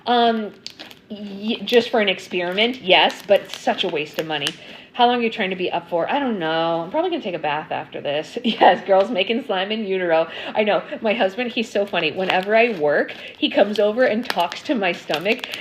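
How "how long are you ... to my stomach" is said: ordinary delivery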